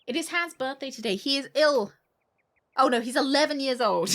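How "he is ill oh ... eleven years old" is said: louder, higher mock dismay; mock alarm. Streamer reacting to the diary's birthday news, mock alarm. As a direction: exclaim it in hammed-up shock.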